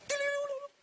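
high whistling sound of the basket twirling; playful sound effect; imitated spinning noise. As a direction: trill it up high like something spinning.

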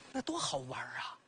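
hushed, breathy aside of delight; curious whisper. Acted. Constable whispering his curiosity about the talking basin, hushed. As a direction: whisper it like a secret thrill.

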